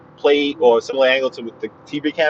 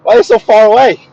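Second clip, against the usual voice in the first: confused; 9 semitones higher, 7 dB louder than usual. Retold or imitated.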